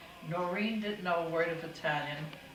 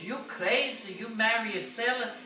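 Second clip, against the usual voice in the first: raised, scolding Italian mother; mock-scolding Italian mother, pitched up; 5 semitones higher, 7 dB louder than usual. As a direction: scold her like an old-world mama. Mother scolding daughter over sailor.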